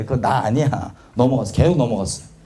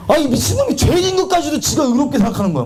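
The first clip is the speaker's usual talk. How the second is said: self-rebuking shout; 8 semitones higher than usual. Real, not acted. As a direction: cry out in disbelief at your own pride. Preacher rebukes his old self.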